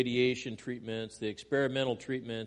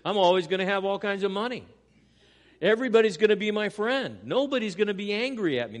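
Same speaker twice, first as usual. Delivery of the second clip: fast, rising, louder mock optimism; gleeful mock certainty, building louder; mocking naive believer; 5 semitones higher, 6 dB louder, faster than usual. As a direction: ham up the naive promise. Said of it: "they think".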